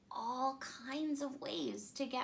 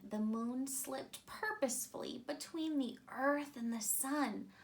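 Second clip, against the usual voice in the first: ordinary delivery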